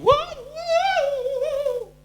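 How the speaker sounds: high piercing shrieks; imitated wild shriek; woman's scream. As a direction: scream like someone seized. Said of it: "dia di situ manifestasi".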